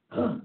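short imitated lion huff; one throaty grunt, short of a roar; gruffing lion. Storyteller imitates the lion walking around, gruffing. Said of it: "he wasn't even roaring".

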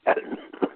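dry cough mid-sentence; coughing fit. Real, not acted. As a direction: break off into a cough. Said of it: "caught me one of my coughing spells".

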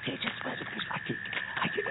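soft whispered caution; hush, they're close. Acted. Close to dogs, hiding.

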